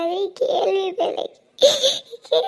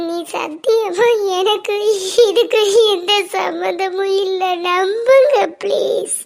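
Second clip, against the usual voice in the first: slow sobbing protest, building louder; crying plea; 3 semitones higher, 3 dB louder, slower than usual. Acted. Girl swears to sister-in-law she's not involved, wailing. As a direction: wail out your innocence, swelling on the final plea. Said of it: "இப்போ நீ அழுதா".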